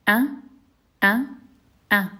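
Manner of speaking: glottal stop before "a"; Arabic hamza sound. Shown as a demonstration. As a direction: catch "a" sharply in the throat. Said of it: "we pronounce it".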